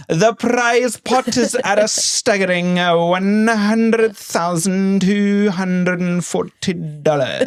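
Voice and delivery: slow, dramatic host build-up; a hammed-up game show host announcing the prize; Alan Cumming impression. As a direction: stretch the prize amount out like a TV host. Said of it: "he goes".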